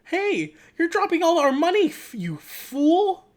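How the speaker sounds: high, loud, hammed-up scolding; angry character yell. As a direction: yell it in outrage, stretching the last word. Acts an angry poster character.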